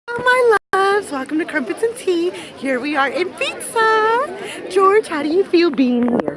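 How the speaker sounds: high, loud, sing-song vlog greeting; big, bright presenter intro; bubbly host voice. Acted. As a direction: greet the fans like a giddy show host.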